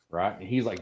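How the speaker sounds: usual speaking voice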